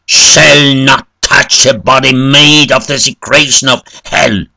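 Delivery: loud, high, vehement clergyman; forceful preacher-like shout; shouted refusal. A narrator's reading. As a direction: thunder the refusal like a condemnation. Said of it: "But the clergyman shouted".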